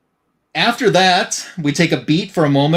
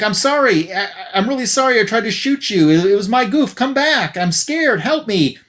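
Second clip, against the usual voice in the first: higher, lightly voiced desperate apology; pleading head; 7 semitones higher than usual. Severed head apologizes and begs her to come back, mildly frightened. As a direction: beg her back like a scared victim, understated.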